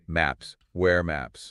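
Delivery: ordinary talk, nothing marked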